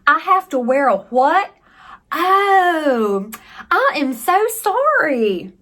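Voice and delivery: high, drawn-out clueless act; dumb American. Acted. Tourist feigning ignorance about masks, playing dumb. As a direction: play dumb with exaggerated apology. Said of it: "I could just play dumb America".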